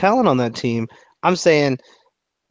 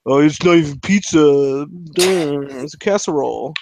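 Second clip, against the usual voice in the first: sneering New Yorker. Retold or imitated. Host mimics New Yorkers mocking Chicago pizza, scoffing. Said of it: "They're like".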